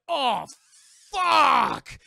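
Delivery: loud, drawn-out high yell; hammed-up cry of dismay, stretched long; Barbosa's horror. Playing Barbosa as the blood drops in, hammed-up yell. Acts Barbosa realizing the curse lifts.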